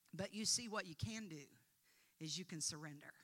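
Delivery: ordinary talk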